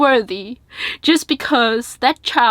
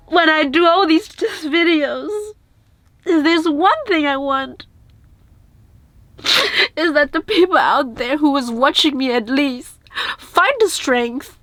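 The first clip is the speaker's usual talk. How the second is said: real tears breaking through the words; choked-up, tearful plea to viewers; crying; 4 semitones higher than usual. Real, not acted. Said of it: "I've been crying".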